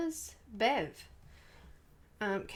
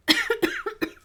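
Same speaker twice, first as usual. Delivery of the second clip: short loud cough; a sudden loud cough; 13 semitones higher, 10 dB louder than usual. Real, not acted. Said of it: "Now I'm coughing".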